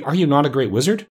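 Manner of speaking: high-pitched surprised question; crying out in disbelief. A narrator's reading. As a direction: ask high, mildly shocked. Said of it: "cried Dorothy".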